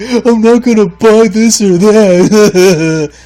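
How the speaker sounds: loud, high whiny mockery of complainers; mocking whiner. Podcaster mocking whiny football fans, loud and high. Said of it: "So you losers, they're on Yahoo saying".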